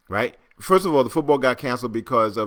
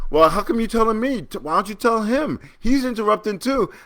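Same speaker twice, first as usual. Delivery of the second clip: high-pitched mock tattling; whiny childish protest; 9 semitones higher than usual. Forty-five answering back to the moderator, childish mocking protest. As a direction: imitate a politician protesting like a kid. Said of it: "You know who else talks like that?".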